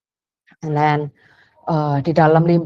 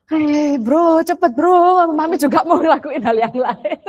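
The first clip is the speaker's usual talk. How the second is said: higher, laughing hurry-up to her son; impatient mom; 7 semitones higher, slower than usual. Retold or imitated. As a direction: tease him to get moving. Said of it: "I was like". (ibu tak sabar sambil tertawa, nada lebih tinggi)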